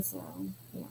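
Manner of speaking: breathy, barely voiced murmur; whispered. Real, not acted. To her co-host, a whispered pretty cool. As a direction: let it fade to a whisper.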